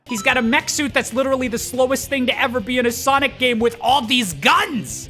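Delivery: high, rising rant; exasperated disbelief. Acted. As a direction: build to an incredulous outburst on guns. Building disbelief at Tails's mech suit.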